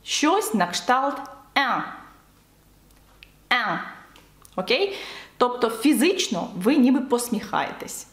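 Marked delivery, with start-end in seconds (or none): 1.5-3.8 "ен" as a French nasal vowel with smile-spread lips; nasal, smiling. Shown as a demonstration. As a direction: say "ен" through the nose as if smiling, no sounded N. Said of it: "вимовляється ніби в посмішці".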